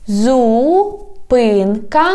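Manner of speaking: "Зупинка таксі" slow, first word in long syllables. Shown as a demonstration. Teacher teaching street words, "Зупинка таксі" slowly by syllables. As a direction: draw out "Зупинка", pause, then say "таксі". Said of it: "divide it into syllables".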